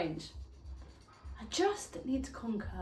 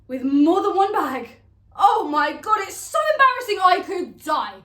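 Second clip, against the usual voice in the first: loud, high, melodramatic dismay; mortified outburst; 8 semitones higher, 11 dB louder than usual. Acted. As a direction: wail it out in exaggerated embarrassment. Acted outrage at embarrassing baggage.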